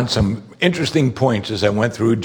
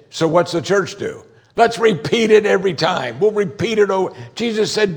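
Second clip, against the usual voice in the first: fiery preacher voice; preaching at full force; 8 semitones higher than usual. Acted. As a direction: rail against rote prayer from the pulpit.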